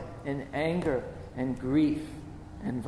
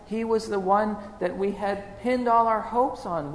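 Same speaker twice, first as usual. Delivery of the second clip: a grieving woman near breaking, rushing then faltering; Mary Magdalene, anguished; 6 semitones higher than usual. Acted. Mary to the angels at the tomb, anguished and rising.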